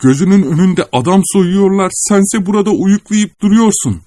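breathless angry scolding. A narrator's reading. Akaki Akakiyeviç, out of breath, scolds the watchman angrily. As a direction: scold him while gasping for air. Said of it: "tıkanırcasına ... çıkışmaya başladı".

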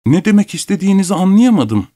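high, indignant voice; acted offended protest; affronted reply. A narrator's reading. Abogin, red-faced, answers the insult.

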